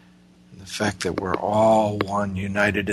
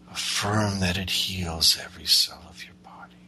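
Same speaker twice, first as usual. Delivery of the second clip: airy breathy mystical healer. Acted. Leads a guided meditation.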